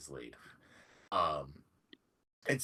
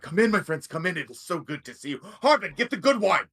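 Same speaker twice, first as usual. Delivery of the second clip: loud, much higher, beaming welcome; overjoyed host; 11 semitones higher, 10 dB louder than usual. Acted. Sildar welcoming the party, calling for good wine. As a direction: call out to friends and servant at once. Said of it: "he's like".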